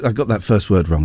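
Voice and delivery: ordinary talk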